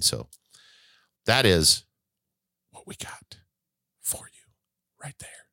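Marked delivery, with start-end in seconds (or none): 2.7-5.5 hushed, voiceless whisper. Real, not acted. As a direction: whisper it under your breath. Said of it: "I am whispering".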